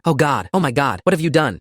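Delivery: a touch of horror, pitch lifted; cracking voice, horrified. A narrator's reading. Landlord backing away from the basement, mildly cracking horrified voice. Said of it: "His voice cracked as he backed toward the front door".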